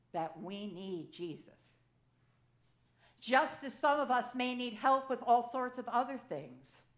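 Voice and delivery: ordinary talk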